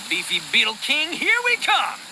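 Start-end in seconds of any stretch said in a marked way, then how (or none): 1.1-2.0 high, loud battle cry; rally the charge with a whoop; excited shout. Acted. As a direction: here we come, yelled up high. Charging off to find beetles.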